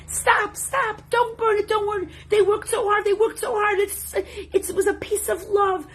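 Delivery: high-pitched, distraught pleading; screaming and crying. Retold or imitated. Children begging the rabbi to stop burning the model, high distraught cries. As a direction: plead frantically, high and breathless with protest. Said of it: "were screaming and crying".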